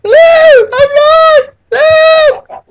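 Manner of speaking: high, loud mock scream for help. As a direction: imitate a lost kid screaming. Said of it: "I can just hear him screaming".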